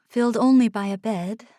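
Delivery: usual speaking voice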